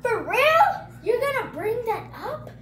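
loud, high incredulous challenge; indignant. Retold or imitated. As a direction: call them out, offended. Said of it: "And I was like".

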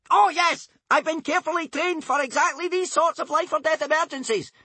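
Bodkin's sudden bravado; crying out. A narrator's reading. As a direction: brag about his training. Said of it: "cried Bodkin".

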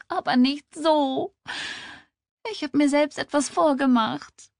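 high, tearful wail; in tears. A narrator's reading. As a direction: act breaking down crying as you confess. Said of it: "brach prompt in Tränen aus". (weinend, mit hoher Stimme)

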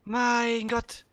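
high urgent cry to run; panicked call to get moving; being chased. Real, not acted. Enemies chasing, urging teammate.

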